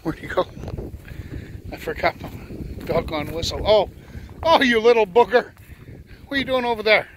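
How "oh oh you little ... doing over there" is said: calling out. Real, not acted. Dog ran off, owner calls.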